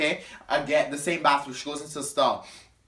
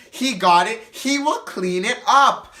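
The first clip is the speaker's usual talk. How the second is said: insistent; 6 semitones higher, 6 dB louder, slower than usual. Retold or imitated. Donette insists he will clean.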